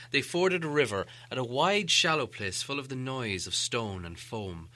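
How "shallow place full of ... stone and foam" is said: usual speaking voice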